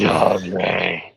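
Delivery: copied deep voice. Retold or imitated. Copying the fishing buddy's bit, mock deep voice. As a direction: echo your buddy's silly deep voice.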